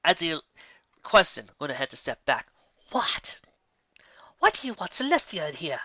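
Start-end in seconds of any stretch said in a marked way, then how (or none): 2.9-5.8 higher, startled questioning; taken aback, then rising protest; puzzled princess. Acted. Luna puzzled.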